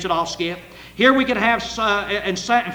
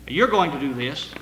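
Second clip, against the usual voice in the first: ordinary talk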